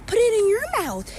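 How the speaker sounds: loud mock toddler echoing the airplane line; little kid voice. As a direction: squeak it like a tiny child copying an adult.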